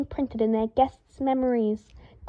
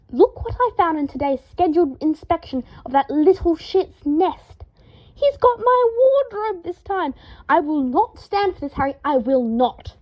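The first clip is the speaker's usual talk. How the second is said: higher, louder indignant tirade; outraged rant; 6 semitones higher, 4 dB louder than usual. A narrator's reading. Draco rants to startled Harry about his wardrobe. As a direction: rant about the thieving ferret. Draco ranting over ferret.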